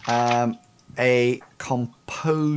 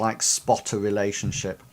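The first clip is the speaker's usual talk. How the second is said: ordinary delivery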